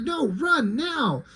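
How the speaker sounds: urgent warning. Acted. Grandpa warns boy of wolf.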